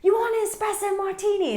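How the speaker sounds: hammed-up British accent offering drinks. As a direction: imitate a showy Brit buying drinks in a British accent. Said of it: "I can do it, uh, a British one".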